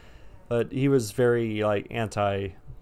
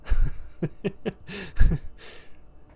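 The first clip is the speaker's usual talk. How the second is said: wheezy, high burst of laughter; laughing; 9 semitones higher, 4 dB louder than usual. Real, not acted. Storyteller tickled by the funniest part, laughing. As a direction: crack up at your own punchline.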